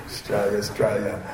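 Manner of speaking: softly drawled Australian "Straya"; Australian accent. Acted. New migrant trying out an identity, Australian accent. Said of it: "start to speak with Australian accent".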